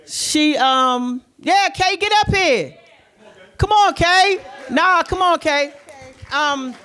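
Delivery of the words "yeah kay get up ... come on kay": high coaxing call across the room; high playful calling. Real, not acted. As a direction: beg her up to the stage. Calling Kay to the stage.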